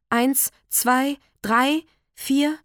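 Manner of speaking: tense counting. Acted. Counting the blows after the beating.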